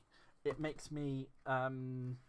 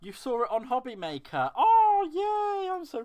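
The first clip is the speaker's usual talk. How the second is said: much higher, louder squeal of joy; delighted squeal; 13 semitones higher, 13 dB louder than usual. Real, not acted. Thrilled that Lynn saw it on Hobby Maker, squealing with joy. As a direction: squeal like the best news ever.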